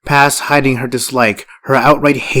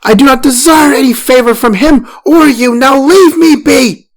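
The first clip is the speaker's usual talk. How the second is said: much higher, very loud furious yell; 8 dB louder than usual. A narrator's reading. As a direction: shout her out of the room. Farah yells at first wife.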